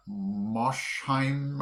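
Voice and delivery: Moshheim drawn out as MOSH-hyme; SH in first syllable. Shown as a demonstration. Explaining his guess, Moshheim as slow MOSH-hyme. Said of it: "put the S H in the beginning syllable".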